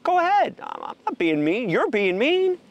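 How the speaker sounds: loud, high-pitched goading; heated retort. Retold or imitated. Man retorting to crying friends at sanctuary, heated loud comeback. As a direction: throw the blame back loudly, voice jumping up.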